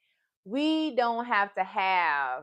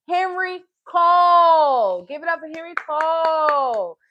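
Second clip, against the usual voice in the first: announcer hype; 5 semitones higher, 8 dB louder than usual. Real, not acted. Hyping the author.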